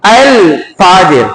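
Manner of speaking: "الفاجر" very slowly, with a distinct L before the f; lam pronounced. Shown as a demonstration. Teacher checks that lam is heard, slow "الفاجر" with lam sounded. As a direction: draw out "الفاجر" and sound its L clearly. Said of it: "یہاں لام کی آواز آ رہی ہے".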